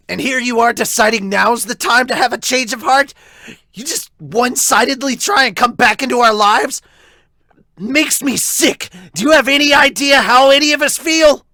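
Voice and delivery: high, loud, all-out yelling at his father; furious shout. Acted. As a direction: scream it in his father's face. Brother explodes at his father.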